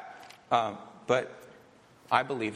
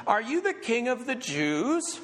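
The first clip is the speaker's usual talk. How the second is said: Pilate's question; 8 semitones higher than usual. Acted. Reading Pilate's question aloud.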